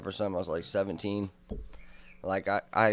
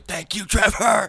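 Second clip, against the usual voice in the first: high, slow, loud sappy thank-you; mock gushing thanks; 13 semitones higher, 11 dB louder, slower than usual. Drunk teen thanking his buddy for staying, hammed-up and high. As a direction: ham up the gratitude.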